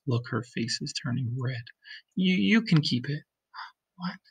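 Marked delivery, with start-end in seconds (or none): 3.2-4.2 soft breathy gasp and question; hushed shock; gasped whisper. Acted. Reader reacts to red face.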